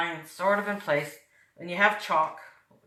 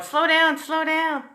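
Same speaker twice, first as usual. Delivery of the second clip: imitating the viewers; 10 semitones higher, 4 dB louder, slower than usual. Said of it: "Everybody going".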